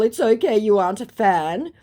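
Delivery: high, loud put-on lilt; mock impostor voice. Impostor replying to her in chat, high mock voice. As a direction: voice the fake, rising at the end.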